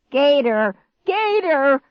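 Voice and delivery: high, loud panicked shriek; shrieking in fright. A narrator's reading. Clark shrieking as something rises from the grass. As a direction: scream the warning. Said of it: "Clark shrieked".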